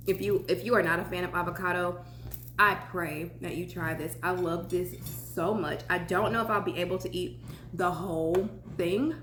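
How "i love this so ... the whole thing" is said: ordinary talk, nothing marked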